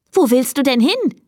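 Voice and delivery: high, puzzled question; baffled. A narrator's reading. Girl Elisa questions her departing cat, baffled. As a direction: ask in surprise, pitched well up. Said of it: "fragte Elisa verblüfft".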